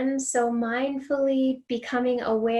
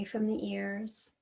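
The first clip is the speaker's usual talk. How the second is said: guided meditation. Real, not acted. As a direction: guide them gently into letting go, softening on each exhale.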